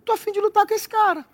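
put-on eager young David. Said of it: "pra perguntar assim".

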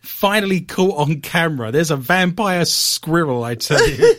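drawn-out, emphatic headline read; fifties newsreel announcer. Acted. Radio host reads a vampire squirrel headline, newsreel announcer style. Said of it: "This sounds very nineteen fifties Pathé News".

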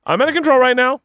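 high-pitched imitation of a sudden admission; mock concession. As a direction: play the other driver giving in, high and hammed-up. Mock concession from the other driver.

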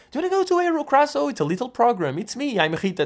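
high, eager starstruck-fan voice; awkward fan pitch. Retold or imitated. Imagined self inviting [name] onto the show, high eager fan voice. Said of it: "eu tipo".

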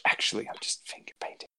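whispered confession; secret whisper. Pretend. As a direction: let the secret slip in a whisper. Confiding a secret.

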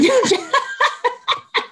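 high bursting laugh; burst of laughter. Real, not acted. Mom laughing while telling of her son's tantrum, high burst of laughter. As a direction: let the laugh break out high and loud.

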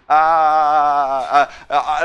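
long, loud wail breaking into stutters; hammed-up cry of dread; panicked wail. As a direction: wail as if being strangled. Acting out panic at doubts.

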